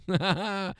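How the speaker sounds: high, put-on taunting laugh; short mocking victory laugh; mock gloating laugh. Gloating as the winning women.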